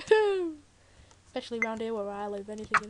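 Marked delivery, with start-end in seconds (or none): 0.1-0.6 mock startled hoot. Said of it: "I'd be like".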